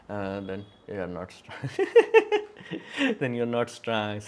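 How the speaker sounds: slow, giggling "then you're not strong"; laughing mock retort. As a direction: tease the critics through laughter. Mocking imagined critics, laughing.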